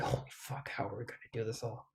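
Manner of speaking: hushed, breathless whisper; awed whisper under the breath; whispered dismay. Retold or imitated. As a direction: whisper it like it's overwhelming. Overwhelmed aside, whispered.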